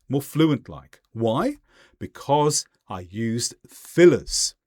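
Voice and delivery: ordinary talk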